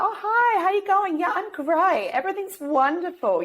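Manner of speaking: chirpy fake greeting to neighbours, everything's fine; fake cheerful. Putting on a fake happy face.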